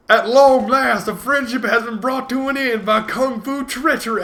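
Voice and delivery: high, loud hammed-up dramatic line; loud, high theatrical proclamation; mock movie villain. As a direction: ham it up like a kung fu film villain.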